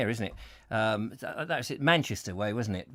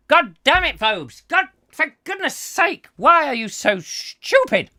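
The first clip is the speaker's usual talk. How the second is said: exasperated shout; 9 semitones higher, 5 dB louder than usual. Acted. Exasperated outburst at a video.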